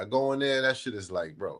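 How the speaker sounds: normal talking voice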